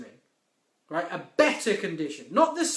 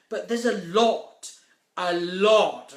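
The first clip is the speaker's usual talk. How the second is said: the second "lot" hit hard and louder; stress on lot; 2 semitones lower, 3 dB louder, slower than usual. Shown as a demonstration. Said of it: "I can't emphasize the word lot".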